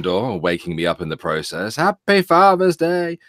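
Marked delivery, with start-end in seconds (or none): 1.8-3.2 put-on kids shouting. Boys bursting in, voiced.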